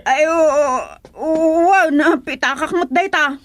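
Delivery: put-on shrill old woman crying out that she is being blamed; agitated old woman. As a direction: cry out like an outraged old woman. Old woman finds her wallet.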